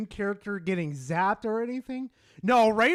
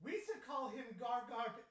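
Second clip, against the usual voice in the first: soft, quick Star Wars sidekick imitation; Jar Jar Binks; 3 semitones higher, 15 dB quieter, faster than usual. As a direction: slip quietly into the goofy alien's voice. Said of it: "do that in, like, his fucking voice".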